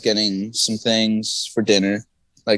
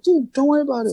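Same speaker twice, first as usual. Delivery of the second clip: high friendly reassurance; faster than usual. Retold or imitated. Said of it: "And I was like".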